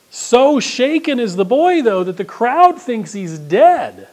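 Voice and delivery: high, loud and slow storytelling; dramatic. A narrator's reading. Preacher dramatizing the shaken boy, high and slow. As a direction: paint the drama broadly.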